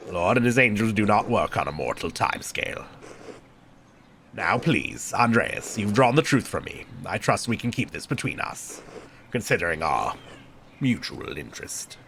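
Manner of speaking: low, soft monk's voice; deep, hushed appeal for discretion; confiding prior. Acted.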